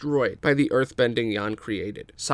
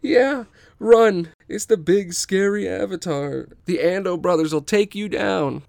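1 mocking jeer; 7 semitones higher, 5 dB louder than usual. Mocking fleeing thugs.

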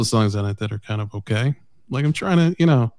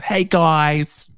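high-pitched mock greeting in a put-on singer's accent; 5 semitones higher than usual. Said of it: "You get [name] in there".

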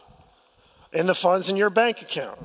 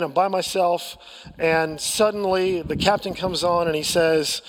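ordinary talk, nothing marked

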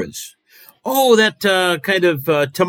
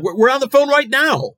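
exasperated; 11 semitones higher, 3 dB louder than usual. Acted. Host exasperated at caller.

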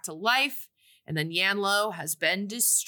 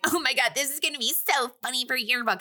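quick, higher gushing schoolgirl voice; a higher, quicker yearbook girl; gushing teen girl; 6 semitones higher, faster than usual. Retold or imitated. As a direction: gush like a mean girl spotting a photo op. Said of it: "Jessica's like".

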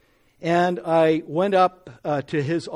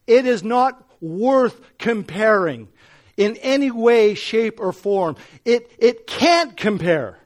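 louder, higher, slow fervent preaching; preacher building to the climax of his testimony; preaching at full force; 5 semitones higher, 8 dB louder, slower than usual. Acted. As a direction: drive it home with fire.

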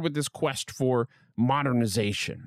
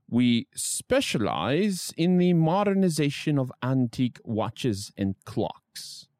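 quoted ad copy in a British voice; mock-British advertising read; British accent; slower than usual. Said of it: "I did a British accent there".

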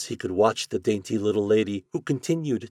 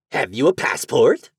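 louder, rough rat voice; water rat; 4 semitones higher, 8 dB louder than usual. A narrator's reading. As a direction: demand like a gatekeeper rat. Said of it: "said the rat".

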